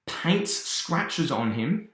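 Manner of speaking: ordinary talk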